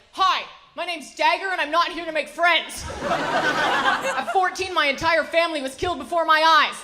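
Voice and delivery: loud, high, tough-guy contestant bravado; brash reality show contestant. Retold or imitated. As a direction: hype yourself up like a cocky TV chef. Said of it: "every contestant on an American cooking show is just like".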